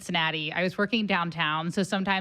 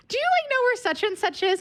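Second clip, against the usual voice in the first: chirpy stranger. Retold or imitated. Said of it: "she goes".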